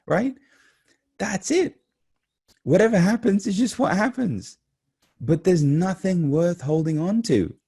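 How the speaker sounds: exultant preaching. Real, not acted. Speaker rejoicing at waking to another day, exultant preaching. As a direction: cry it out high with elated conviction.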